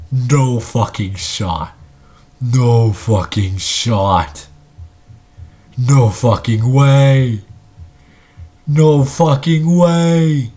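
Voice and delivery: drawn-out, disbelieving exclamations; stunned disbelief. Real, not acted. Commentator stunned by a neck and neck fight, in drawn-out disbelief. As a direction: stretch every word out in shock.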